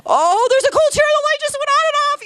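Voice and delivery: high, loud, fast frightened call; yelling for friends in a fright; frightened holler. Retold or imitated. Calling friends downstairs after the flickering light, high frightened holler. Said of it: "I hollered for you guys. I'm like".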